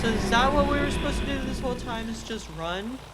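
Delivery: breathless disbelief after escaping; surprised. Real, not acted. Relief after escaping monster.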